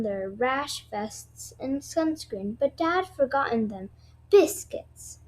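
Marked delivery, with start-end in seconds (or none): 4.3-5.2 mild exclamation. Acted. Dad forgot sunscreen.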